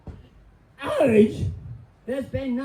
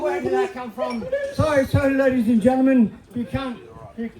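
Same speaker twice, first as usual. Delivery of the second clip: ordinary talk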